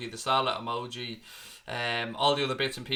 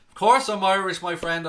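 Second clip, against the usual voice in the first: "Of course I'm Irish" burst out much louder and higher in a hammed-up Irish accent; loud mock Irish; 5 semitones higher, 8 dB louder than usual. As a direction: belt it out as a cartoon Irishman. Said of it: "am I Irish or English?".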